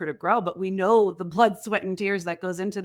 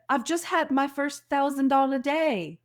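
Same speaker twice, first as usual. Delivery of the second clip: a lightly put-on, higher Australian accent with a rising lilt; horrific Australian accent; 7 semitones higher than usual. A friend casually reports her big sales day, in a badly done Australian accent. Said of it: "this is my horrific Australian accent".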